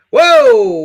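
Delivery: loud, high, drawn-out "whoa"; big whoa of surprise; surprised whoa. Real, not acted. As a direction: exclaim it high and loud at new donations. Reacting to new donations coming in.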